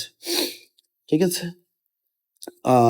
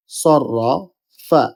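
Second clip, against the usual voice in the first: slow সররা, pause, then ফা; broken into syllables; 2 semitones higher, much slower than usual. Shown as a demonstration. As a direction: draw out সররাফা in separate parts. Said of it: "প্রথম হরফের সাথে এটা মিলিত হবে". (সররা... ফা: ধীরে ভেঙে ভেঙে)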